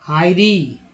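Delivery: "हायरी" with a long "आई" glide in the first syllable. Shown as a demonstration. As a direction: say "हायरी" as HAI-ree, like a word drill. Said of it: "आई का उच्चारण यहाँ पर आई के रूप में हुआ है". ("हायरी" में "आई" का साफ़ उच्चारण)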